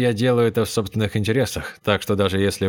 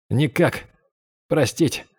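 higher, hushed, like confessing sins; faint confession; 6 semitones higher, slower than usual. Acted. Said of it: "Словно покаяние в грехах". (еле слышное покаяние)

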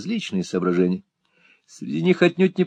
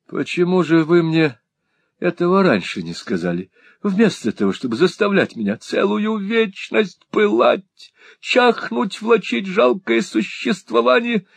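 much higher, theatrical pining; lovesick complaint; 11 semitones higher than usual. A narrator's reading. Young lord complaining to his friend, much higher. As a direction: whine as though dying of love.

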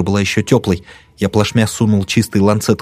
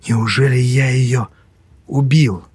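slow, stunned question; distant, dazed; 4 semitones higher, slower than usual. A narrator's reading. Hauk answers his friend in shock, dazed. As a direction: speak as if from far away. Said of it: "как издалека".

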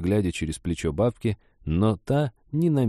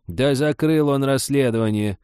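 put-on vexed old woman, pitched up; high, irritated retort; annoyed; 7 semitones higher, 4 dB louder than usual. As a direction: toss it out in annoyance. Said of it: "с досадой бросила старуха".

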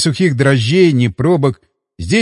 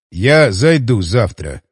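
a bit tipsy, slow and firm; a little drunk and resolute; slightly drunk; 6 semitones lower, slower than usual. A narrator's reading. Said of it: "чуть пьяновато и решительно сказал Хмель".

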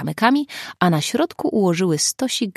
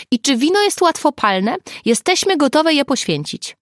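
high, raised voice; louder, higher, projected over the wind; calling over wind; 9 semitones higher, 5 dB louder than usual. A narrator's reading. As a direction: call eagerly down a noisy phone line. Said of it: "wiatr ... skutecznie zagłuszał ich rozmowę".

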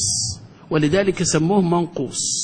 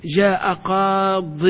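جاء قاض stretched word by word; slow example sentence; much slower than usual. Shown as a demonstration. Teacher reading an example slowly for students. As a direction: dictate it slowly so they hear no ya. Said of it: "شو تقول؟".

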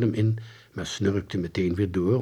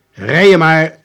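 called out loud and high, brushing him off; boy shouts at the taxi driver to drive off, called out; mock call out; 6 semitones higher, 13 dB louder, slower than usual. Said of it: "riep hij".

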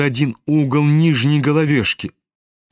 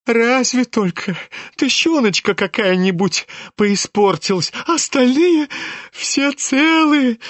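tenderly moved; 8 semitones higher than usual. A narrator's reading. Lebedev relieved over the money, high cooing tenderness. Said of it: "с умилением выговорил Лебедев".

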